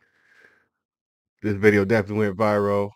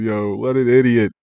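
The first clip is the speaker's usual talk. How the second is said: normal talking voice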